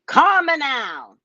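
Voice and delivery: a lightly put-on Caribbean psychic call, Calm now drawn out; Miss Cleo accent. Shown as a demonstration. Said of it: "I've been practicing all day".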